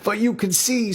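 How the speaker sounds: petition the guru eagerly; eager seeker Roy. Acted.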